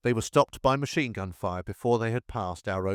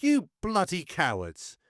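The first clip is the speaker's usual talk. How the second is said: shouting; 6 semitones higher than usual. A narrator's reading. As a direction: hurl it at them, stunned they won't move. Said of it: "He shouted".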